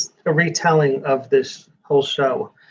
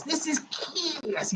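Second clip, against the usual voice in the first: slow, softer, higher hammed-up announcer voice; 9 semitones higher, 13 dB quieter, much slower than usual. Announcing Kiss to the viewers, hammed-up slow announcer. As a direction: stretch it out like a mock rock-show intro. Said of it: "You can't say it like that".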